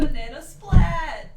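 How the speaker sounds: loud, slow, punchy cartoon caption; booming silly banana splat; comic announcement. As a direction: shout it like a comic sound effect. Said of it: "sound effect narration".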